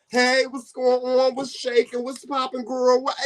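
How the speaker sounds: high, excited friendly greeting; bubbly greeting. Retold or imitated. Speaker greeting friends, high and bubbly. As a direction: gush a hello like a party host. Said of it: "Now, me, on the other hand, I'm gonna be like".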